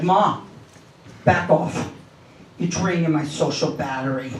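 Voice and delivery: low, weary put-down; mock-deadpan teenage complaint, pitched low; imitated fed-up son. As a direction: play a son low and unimpressed, brushing mom off. Son brushes off his mother.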